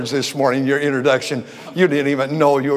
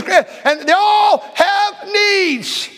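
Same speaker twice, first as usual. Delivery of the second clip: preaching shout; much slower than usual. Real, not acted. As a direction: let it ring out to the church.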